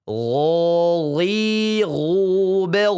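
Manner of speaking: "Gho, ghi, ghu, bel" with a smooth throat gh, no rasp; smooth gargled ghain chant; ghain drill. Shown as a demonstration. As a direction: glide "Gho" from the upper throat. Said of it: "Huruf ghain".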